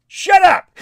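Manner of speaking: loud, high-pitched yell; shouting. Retold or imitated. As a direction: snap it out as a sudden shout. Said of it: "telling him".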